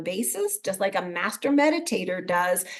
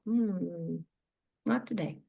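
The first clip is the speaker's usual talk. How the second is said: calm refusal; 3 semitones lower, 8 dB quieter, slower than usual. Acted. Calmly catching rising adrenaline.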